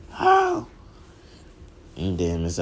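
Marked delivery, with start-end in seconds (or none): 0.1-0.7 very high, loud outraged squeak; frustrated squeal. Acted. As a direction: squeal it in disbelief. Bakugo frustrated.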